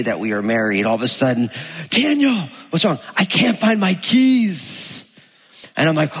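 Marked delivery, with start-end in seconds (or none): 1.9-4.9 wife searching. Retold or imitated. Wife voiced in a story.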